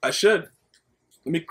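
ordinary talk

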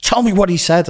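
high-pitched mock demand; retold demand. Partner pressing him about therapy, high mock demand. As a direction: imitate an insistent partner, pitched well up.

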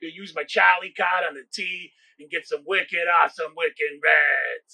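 hammed-up Boston accent, shouted ending. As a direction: go full Boston, yell the end. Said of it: "Sorry, Boston".

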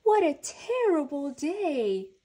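high, loud lament; loud, dramatic complaint; wailing. A narrator's reading. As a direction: wail it in dismay. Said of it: "wailed Franklin".

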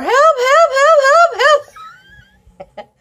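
high loud help calls; panicked cries. Acted. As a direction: cry for help. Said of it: "I muttered".

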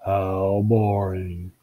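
slow, low monotone groan; weary low drawl; bored groan. Acted. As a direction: groan it out, dragging each word. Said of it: "groaned Bill".

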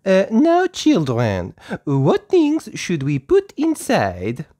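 high, slow, fluttery French teacher voice; a gentle teacher speaking slowly to little children; Madame Gazelle voice. Acted. Teacher to her playgroup, high and slow.

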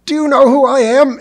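very high, very loud outraged yell; spluttered outburst. A narrator's reading. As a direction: splutter it out at the top of your voice. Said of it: "he spluttered".

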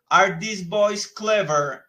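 slow English with a put-on voice; slow model question. Shown as a demonstration. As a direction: exaggerate each word for learners. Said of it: "Is this".

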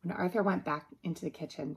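ordinary delivery

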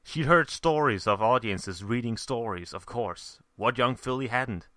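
ordinary delivery

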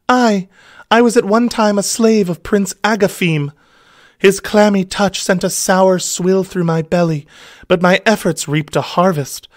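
high, breathy-free damsel voice. Acted.

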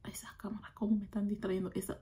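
very soft, half-voiced grumble to self; whispered aside. Real, not acted. Vlogger distracted by the cameras, whispered aside. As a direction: drop to a whisper, stepping out of the story.

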